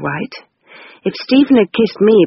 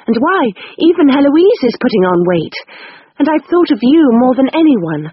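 higher, earnest pleading; Rose's voice; 6 semitones higher than usual. Acted. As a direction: explain it all with feeling. Rose defending herself.

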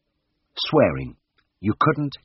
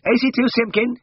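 high, loud piercing call across the room; 4 dB louder than usual. A narrator's reading. Orderly calls the next patient from the doorway, piercingly high and loud. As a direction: call the name out sharp and shrill from the doorway. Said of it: "piercingly from the doorway".

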